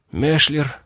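usual speaking voice

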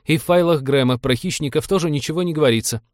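high-pitched self-justification; defensive navigator voice; defensive excuse. A narrator's reading. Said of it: "привычно начал оправдываться навигатор".

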